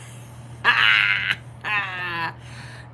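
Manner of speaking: a big burst of real laughter. Real, not acted. Laughs at a chat comment.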